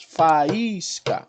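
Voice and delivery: "Faísca" in three drawn-out beats, fa-ÍS-ca; syllable separation. Shown as a demonstration. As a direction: hold the stressed I apart from A in "Faísca". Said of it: "Olha as ocorrências aí de separação dos sons". ("Faísca" com os sons bem separados)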